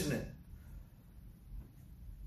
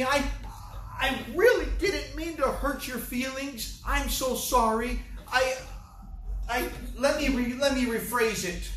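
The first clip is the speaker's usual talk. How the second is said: higher, pleading, hammed-up apology; mock apologetic Jesus; 5 semitones higher than usual. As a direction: beg forgiveness like a timid people-pleaser. Pastor mocks an apologetic Jesus.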